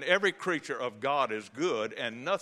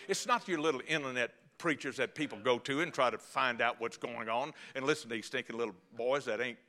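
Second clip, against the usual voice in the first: ordinary delivery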